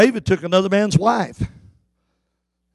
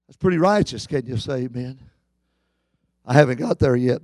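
normal talking voice

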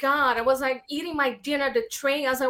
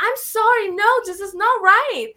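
indignant protest; 8 semitones higher, 5 dB louder, faster than usual. Retold or imitated. Retelling her own protest.

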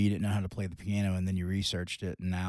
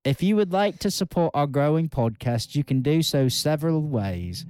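upbeat announcer read, pitched higher; podcast ad read; 4 semitones higher, 4 dB louder than usual. Acted. Co-host reads the support plug to listeners as an upbeat announcer. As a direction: sell the support plug like a promo.

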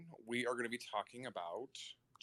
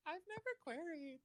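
mock sheepish high voice; 6 dB quieter than usual. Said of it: "And I'm like".

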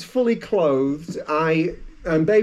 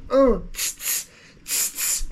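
snipping noises; 7 semitones higher than usual. Pretend. Hairdresser snipping sound effect.

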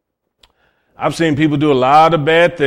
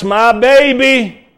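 slow, higher, doting mother's voice; doting mama; 7 semitones higher, 3 dB louder, slower than usual. Retold or imitated. A mom defending her grown son, mild doting coo. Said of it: "That's how she talks".

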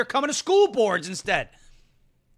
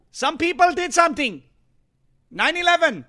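higher, louder goofy mock; Jar Jar voice; 7 semitones higher, 6 dB louder than usual. Host mocking the congresswoman, higher and louder. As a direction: squeak it out dismissively. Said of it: "Jar Jar Binks".

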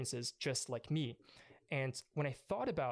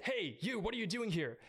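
high, sharp call from across the road; a worker catching a trespasser by the fence, raised high challenge; 7 semitones higher than usual. Retold or imitated.

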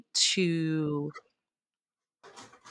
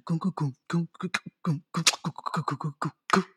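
steady beatboxed groove on "goom"; vocalized groove; 4 dB quieter, faster than usual. Shown as a demonstration. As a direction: mouth out a looping drum pattern. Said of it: "Oh, wait, do that again".

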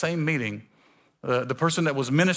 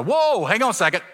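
higher, louder startled cry; startled whoa; 7 semitones higher, 5 dB louder than usual. Retold or imitated. Pastor overwhelmed by God's blessing, startled. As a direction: pull back, overwhelmed. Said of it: "I go".

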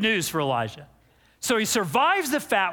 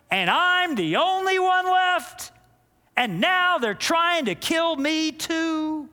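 self-pitying whine; 7 semitones higher, slower than usual. Retold or imitated. Elijah's self-pitying complaint.

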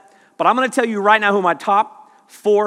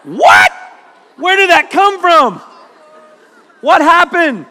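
higher, louder bewildered what; mock shocked confusion; 9 semitones higher, 7 dB louder than usual. Preacher mimicking his own baffled what-happened. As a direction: act baffled at your own slang. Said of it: "all of a sudden you're like".

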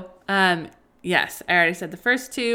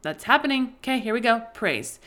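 higher and louder, bright noticing tone; cheerful catch-yourself; 4 semitones higher, 3 dB louder than usual. Pretend. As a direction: catch the grumble, then pivot to praise. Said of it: "your perfect cue to be like".

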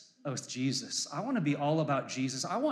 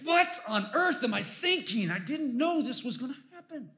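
high, lightly voiced panicking God; a light impression of God baffled by the rebellion; mock flustered God; 8 semitones higher than usual. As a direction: play God caught off guard, hammed-up. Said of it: "and he's like".

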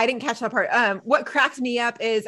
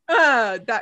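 normal talking voice

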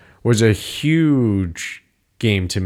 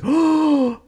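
a much higher, drawn-out knowing "oh"; knowing friend. Retold or imitated. Roommate Chet realizing the big choice is here, high knowing "oh". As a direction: voice the friend who knows what's coming.

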